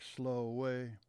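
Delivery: ordinary delivery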